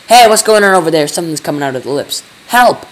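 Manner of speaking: high, loud cries of alarm; alarmed shouting. A narrator's reading. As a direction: shout in sudden panic for help. Sudden alarm at something emerging.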